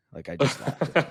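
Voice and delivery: half-laughing confession. Real, not acted. Laughs while admitting he snapped.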